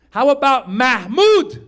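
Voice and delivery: high, slow proud name reveal; proud pitch. Acted. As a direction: roll the name out grandly like a winning offer. Pitching a name to his wife.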